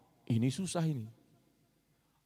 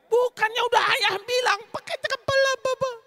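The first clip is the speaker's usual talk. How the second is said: much higher, fast, exasperated scolding; mock scolding parent; 4 dB louder than usual. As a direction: nag the child like an irritated father. Preacher acts scolding parent. (ayah mengomel tinggi dan cepat)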